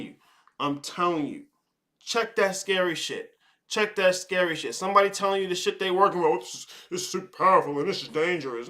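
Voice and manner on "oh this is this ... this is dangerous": mocking. Mocking people hyping their practice.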